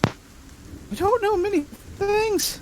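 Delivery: very high, odd little creature; squeaky creature voice. Acted. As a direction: squeak every line way up high. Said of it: "Sounds like a cat".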